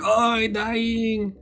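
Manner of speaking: high, very slow, fading dying voice; dying little guy. Acted. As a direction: stretch it out like your last breath.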